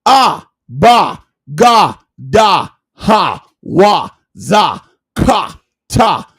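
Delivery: letter sounds chanted one by one, each syllable "Ba", "Ga", "Da" spoken short and open; Hebrew alphabet recital. Shown as a demonstration. Reciting the Hebrew alphabet to viewers to show there is no J, chanted letter by letter. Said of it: "The true Hebrew alphabet".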